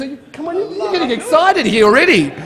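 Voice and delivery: excited, high-pitched teasing of an audience; hyping the crowd. Real, not acted. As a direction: work the crowd up, pitch soaring. Hyping up audience before chant.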